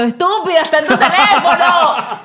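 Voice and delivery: high, slowed mock shout at oneself; imitated yelling. Guest voicing listeners yelling at her, mock shouting. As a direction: mimic exasperated listeners yelling, pitch jumping up. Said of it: "ustedes estaban gritando".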